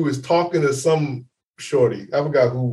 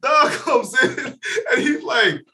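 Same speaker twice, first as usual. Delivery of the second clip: usual speaking voice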